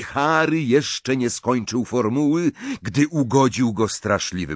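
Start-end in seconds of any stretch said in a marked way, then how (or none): none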